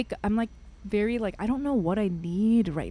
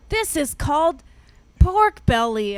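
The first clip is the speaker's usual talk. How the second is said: raised, emphatic explaining voice; patronizing explanation; 6 semitones higher, 7 dB louder than usual. Retold or imitated. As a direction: announce it loudly as if to a foreigner. Explaining Korean food to her.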